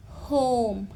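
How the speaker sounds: होम with a long, held ओ; long vowel. Shown as a demonstration. Teacher demonstrating the lengthened O sound, long vowel. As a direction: stretch out the ओ in होम. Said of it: "थोड़ा सा हमने long कर दिया है".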